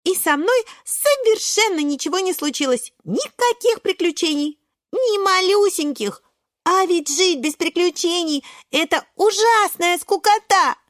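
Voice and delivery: put-on high, insistent child character; earnest little Шпинатик. Шпинатик explaining to doubting friends, high and insistent. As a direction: plead the case in a high, emphatic voice.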